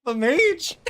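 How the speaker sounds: high, loud incredulous question; can't believe what just happened; stunned disbelief. Real, not acted. Streamer reacting to a surprise kill, high disbelieving cry.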